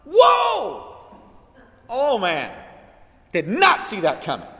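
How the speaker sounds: high, loud startled whoa fading down; mock shock. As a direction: ham up God's surprise. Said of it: "looked at your osap debt and gone".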